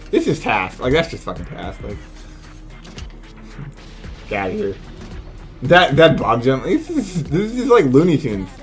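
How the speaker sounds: ordinary talk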